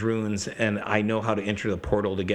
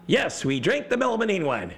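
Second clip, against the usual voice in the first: high, emphatic insistence; mock-exasperated player voice; 10 semitones higher than usual. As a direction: repeat it impatiently, pitched way up.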